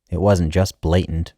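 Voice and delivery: ordinary delivery, nothing marked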